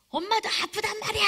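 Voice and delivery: high-pitched, acted angry outburst; hurt mother snapping back. Mother hitting back at her child, angry protest. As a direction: yell it in protest, as a mom at her limit.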